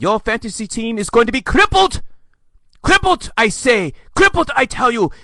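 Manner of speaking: high, hammed-up alarm; mock doomsayer. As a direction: proclaim doom in an exaggerated high voice. Mocking doomsayers' sweeping generalization.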